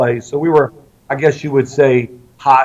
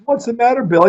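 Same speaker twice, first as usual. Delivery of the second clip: wife asking; 9 semitones higher than usual. Retold or imitated. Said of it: "And my wife says".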